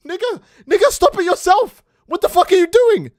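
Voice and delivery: high, loud mocking shout; mock yelling back. Bull mocking the boyfriend, high loud hammed-up shout.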